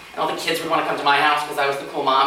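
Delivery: normal talking voice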